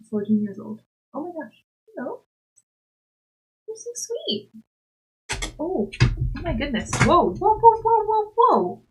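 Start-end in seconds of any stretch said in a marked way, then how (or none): 1.1-6.9 high soft cooing; high tender pet voice; cooing at cat. Real, not acted. 7.0-8.8 high, louder alarmed whoas; rising alarmed whoa; startled whoa. Real, not acted. Cat breaking things.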